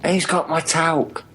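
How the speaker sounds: usual speaking voice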